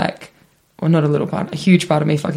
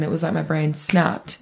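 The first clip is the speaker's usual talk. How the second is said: normal talking voice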